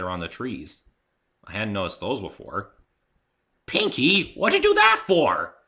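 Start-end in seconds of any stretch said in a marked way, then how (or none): 3.7-5.6 higher and louder, hurt; indignant complaint. A narrator's reading. The narrator, hit by an apple, complains to Pinky, indignant. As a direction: demand an answer.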